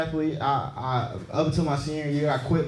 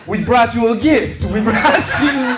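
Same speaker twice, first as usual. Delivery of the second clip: mock gift presentation; 5 semitones higher, 6 dB louder, slower than usual. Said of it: "You're like".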